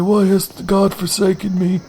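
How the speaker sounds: high, anguished lament; hammed-up old monk; despairing monk. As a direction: plead to heaven in mock despair. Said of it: "I was like".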